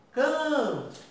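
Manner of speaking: high, drawn-out CAN in "Canto"; sílaba tônica destacada. Shown as a demonstration. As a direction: lean long on the first syllable of "Canto", letting the end drop away. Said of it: "qual é a sílaba mais forte de canto". ("Canto" com a primeira sílaba longa e aguda)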